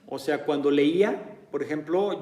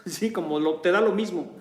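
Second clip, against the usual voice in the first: ordinary delivery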